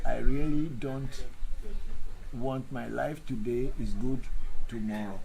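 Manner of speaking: each word spaced out slowly; deliberate slow dictation; slow, careful repeat. Shown as a demonstration. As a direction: say it again slowly and clearly. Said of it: "once again, please".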